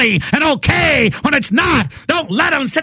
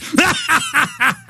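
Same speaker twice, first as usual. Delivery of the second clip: gleeful laugh; 6 semitones higher, 3 dB quieter than usual. Real, not acted. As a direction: laugh in triumph at your enemies. Gloating over enemies' defeat.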